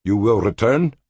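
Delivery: lightly voiced Cultan begging; rising plea. A narrator's reading. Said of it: "Cultan begged".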